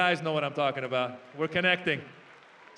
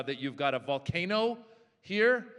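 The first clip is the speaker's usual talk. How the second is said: usual speaking voice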